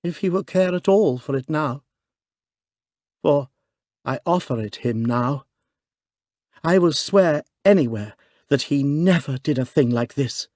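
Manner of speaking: wan smile. Acted. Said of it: "she added with rather a wan smile".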